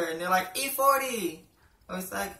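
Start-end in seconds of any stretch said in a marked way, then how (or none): 0.6-1.5 higher-pitched, drawn-out reply; put-on higher coworker voice; language-barrier coworker. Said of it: "like, a language barrier".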